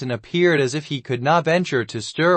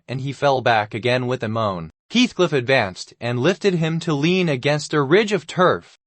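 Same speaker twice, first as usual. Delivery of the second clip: usual speaking voice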